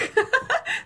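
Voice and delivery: a high, loud giggle at her own slip; high burst of laughter. Real, not acted.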